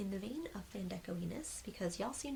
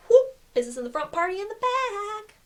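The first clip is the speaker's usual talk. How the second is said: playful catchphrase; 12 semitones higher, 15 dB louder than usual. Real, not acted. To viewers, joking about the tee's mullet design, loud gleeful whoop. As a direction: sell the punchline with a big whoop.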